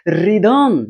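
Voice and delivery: teacher presenting रिदम; model word rhythm. Shown as a demonstration. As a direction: announce रिदम as ri-dam, h silent. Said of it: "अर्थात इसको नहीं पढ़ते".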